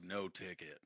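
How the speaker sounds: very soft, low acted line; low deadpan reply. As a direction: drop it quietly, like a film hero's one-liner. Acted reply to the zombie creature.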